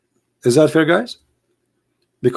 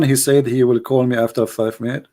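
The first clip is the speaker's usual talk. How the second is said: ordinary delivery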